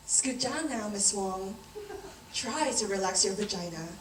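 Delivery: low, soft examiner's instructions; clinical doctor. Acted. Doctor instructing Miss Wong on the table, clinical and quiet.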